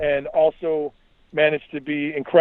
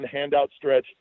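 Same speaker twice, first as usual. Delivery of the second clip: plain reading voice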